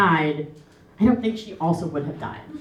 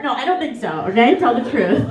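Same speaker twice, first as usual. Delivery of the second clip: normal talking voice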